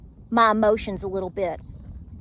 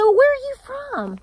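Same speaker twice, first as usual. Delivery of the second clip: chirpy retold question, much higher; eager friendly question; 13 semitones higher, 3 dB louder than usual. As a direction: ask it with a big upward leap.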